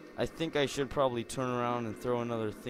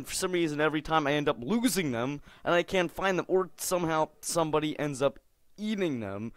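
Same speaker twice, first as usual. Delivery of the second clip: ordinary delivery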